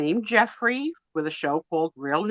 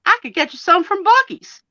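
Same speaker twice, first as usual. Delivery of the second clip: put-on Southern accent; 9 semitones higher, 5 dB louder than usual. Friend offering peanut brittle, in a high imitated Southern accent. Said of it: "in her Southern accent".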